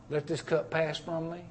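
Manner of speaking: soft, hushed Let this cup pass from me; hushed prayer. Retold or imitated. Preacher quoting Jesus in the garden, hushed. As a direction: breathe the garden prayer softly.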